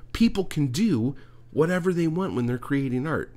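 rip into the critic, voice up high; angry rant. Real, not acted. Podcaster ranting at a poetry critic, furious and high. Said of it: "I'm all fucking pissed off".

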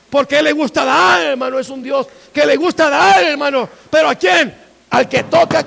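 fiery pulpit exhortation; full-force preaching. Real, not acted.

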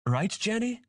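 put-on very high childlike question; young dragon asking. A narrator's reading. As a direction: seek agreement in a little kid's voice. Young dragon seeks agreement.

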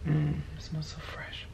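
soft breathy whisper, savouring. Real, not acted. Vlogger sniffing her new cardigan, a savouring whisper. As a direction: whisper it like you're savouring a smell.

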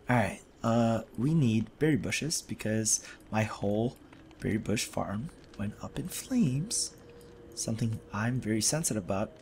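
dejected mumbling. Real, not acted. Gamer after losing his berry farm, quiet and defeated. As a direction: let the words sag, beaten and quiet. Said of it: "I've never felt so defeated".

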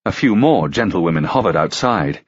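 plain reading voice

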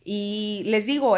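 normal talking voice

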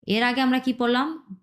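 ordinary talk, nothing marked

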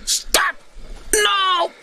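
high-pitched hysterical scientist; panicked Half-Life scientist. Acted. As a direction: squeal in doom-laden desperation. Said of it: "quoting Half-Life scientist quotes".

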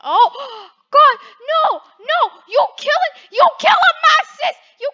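high, very loud outraged screams; hammed-up horror. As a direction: scream in mock outrage for your sister. Acted horror at sister's attack.

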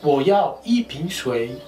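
Wo yao yi ping shui spoken slowly with yi stressed; teacher model read; slow model phrase. Shown as a demonstration. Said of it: "En un chino mejor sería".

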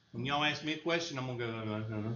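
softly mumbling the question back; imitating a student who mumbles; mock mumbling. Shown as a demonstration. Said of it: "Enunciate and volume".

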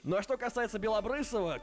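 normal talking voice

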